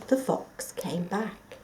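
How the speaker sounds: ordinary delivery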